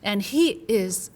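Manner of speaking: slow, loud, bitter outburst; furious patient voice, drawn out; angry patient. Retold or imitated. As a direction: hammer out the lost years. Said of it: "he's not joking".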